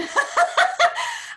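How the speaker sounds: high, loud burst of laughter; giggling at a viewer's comment; delighted laugh. Real, not acted.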